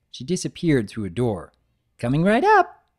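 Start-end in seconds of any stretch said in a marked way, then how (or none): 2.0-2.9 high, loud, bouncy greeting; Pinkie Pie voice. Acted. Pinkie greeting Big Mac at the counter, high and bubbly. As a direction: burst in cheerfully.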